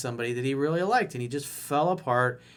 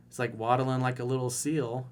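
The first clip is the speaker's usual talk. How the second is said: normal talking voice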